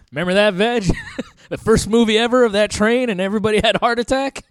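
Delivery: normal talking voice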